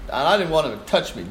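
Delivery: normal talking voice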